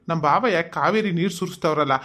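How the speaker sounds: ordinary delivery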